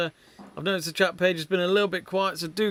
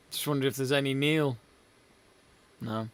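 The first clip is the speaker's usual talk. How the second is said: ordinary delivery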